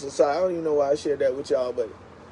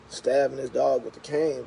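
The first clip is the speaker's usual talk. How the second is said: ordinary talk, nothing marked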